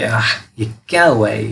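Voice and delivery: mock scornful "आह", slowed down; contemptuous noise. Shown as a demonstration. Teacher demonstrating a contemptuous noise to learners, mock contempt. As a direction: act out a hateful, disgusted reaction. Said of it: "she made a contemptuous noise".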